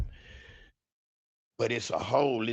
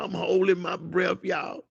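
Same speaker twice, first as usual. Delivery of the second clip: ordinary talk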